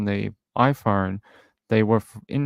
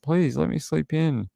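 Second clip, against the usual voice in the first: slow, higher-pitched retold plea; mock pleading; 6 semitones higher than usual. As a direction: act out begging to be left asleep.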